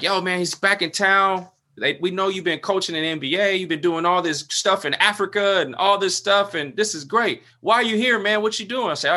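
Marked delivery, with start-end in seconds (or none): 0.0-7.4 retold hometown greeting, pitched up high; excited welcome. Friends hail a returning local hero, excited. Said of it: "Everybody's excited". 7.6-8.9 excited questioning. Retold or imitated. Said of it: "Everybody's excited".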